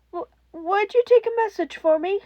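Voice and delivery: put-on a scared kid on the phone; a child caller. A narrator's reading. Frightened child asks the hero to pass a message, timid. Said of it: "the timid reply".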